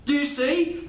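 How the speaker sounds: ordinary talk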